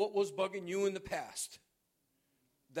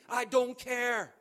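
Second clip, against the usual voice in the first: loud, higher I don't care; emphatic; 5 semitones higher, 8 dB louder than usual. Acted. Preacher speaking for God to complainers, loud and firm. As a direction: slam it down hard. Repeated slower.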